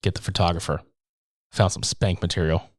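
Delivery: mock creepy mutter. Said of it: "the way that they delivered this line".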